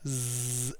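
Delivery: Z as a long buzzing zzz; sustained soft buzz for Z, no vowel; isolated Z sound. Shown as a demonstration. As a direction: hold the consonant Z, voiced, softly. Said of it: "it's a Z sound at the end".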